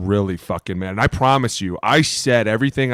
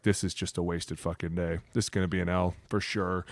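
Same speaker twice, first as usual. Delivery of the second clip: much softer, lower, defeated mutter; dejected; 4 semitones lower, 11 dB quieter than usual. Retold or imitated. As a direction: give up on the day under your breath. Dejected thoughts while being booed.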